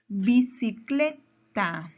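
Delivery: "Bicicleta" slowly split, CLE punched; very slow teacherly syllables, "Bicicleta" stressed on CLE. Shown as a demonstration. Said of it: "mayor fuerza de voz".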